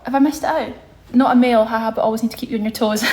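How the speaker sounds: ordinary talk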